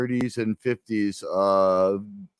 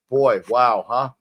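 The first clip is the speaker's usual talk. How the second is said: usual speaking voice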